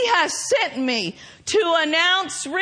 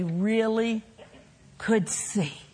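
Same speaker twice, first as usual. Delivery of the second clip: lower, softer preaching, drawing them in; soft intimate preaching; 7 semitones lower, 7 dB quieter than usual. Real, not acted. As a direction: hush it down and plead gently.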